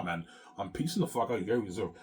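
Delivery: ordinary talk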